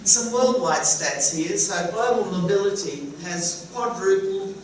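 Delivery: ordinary delivery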